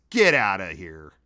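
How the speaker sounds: ordinary talk, nothing marked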